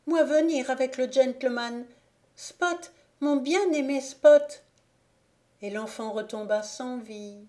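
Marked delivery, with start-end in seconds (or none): 0.1-4.6 much higher, ringing joyful cry; ecstatic cry. A narrator's reading. Dying boy greets his dog in ecstasy, high joyful cry. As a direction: cry the dog's name in rapture. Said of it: "cria Dinky dans une sorte d'extase".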